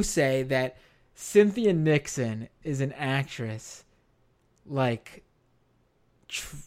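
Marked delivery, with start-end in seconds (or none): none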